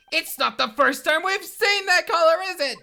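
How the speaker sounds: high, loud excited discovery; excited realization. Real, not acted. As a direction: blurt it out excitedly.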